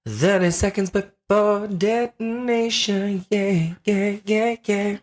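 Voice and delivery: high, slow robotic announcer. Retold or imitated. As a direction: announce it like a computer countdown. Repeats the automated voice line.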